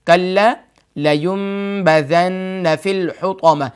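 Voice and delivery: slow, melodic tajweed recitation; Quran recitation. Shown as a demonstration. Teacher reciting the verse for his viewers, slow and chanted. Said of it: "آیت number چار ہے".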